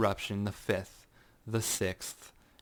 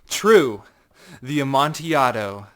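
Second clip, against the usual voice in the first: loud, higher, sneering; taunting echo; 4 semitones higher, 13 dB louder than usual. Acted. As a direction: toss the word back at him mockingly. Montresor echoes, taunting.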